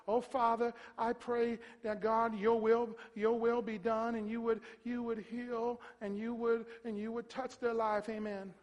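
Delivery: halting, monotone prayer with no conviction; timid sick-bed prayer. Acted. Preacher mocking a prayer over the sick, timid. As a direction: mumble through a hesitant, weak prayer. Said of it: "You want somebody praying for you like that?".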